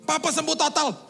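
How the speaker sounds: high and loud, overjoyed; excited caller. Retold or imitated. Family member telling the pastor the father recovered, high and loud. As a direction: shout the good news.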